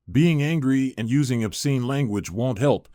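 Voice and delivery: ordinary delivery, nothing marked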